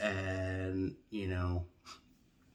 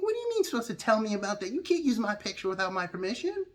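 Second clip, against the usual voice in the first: higher and fast, indignant rant; outraged protest; 12 semitones higher, faster than usual. Retold or imitated. Arguing with the DMV staff, fast high indignant rant.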